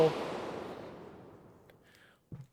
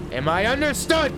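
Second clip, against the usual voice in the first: repeated higher and louder, demanding; father repeating his demand, louder; 11 semitones higher, 5 dB louder than usual. Acted. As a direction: snap it back louder as a furious father. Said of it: "I said".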